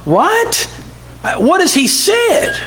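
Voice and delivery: as if he can't believe it; puzzled Pilate. Retold or imitated. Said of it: "he thought".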